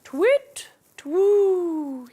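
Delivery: high hooting owl voice. Acted. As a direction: hoot it out like an owl. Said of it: "he says".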